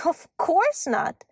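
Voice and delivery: high, slow, drawn-out retort; dismissive elf. A narrator's reading. Elf answering girl who knows nothing, dismissive. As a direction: stretch it out as if obvious.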